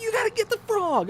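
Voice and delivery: very high, fast squeal; imitating a panicked wife; crazy-sounding wife. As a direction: beg frantically in mock hysteria. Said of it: "I'm making her sound like she's, like, this crazy person".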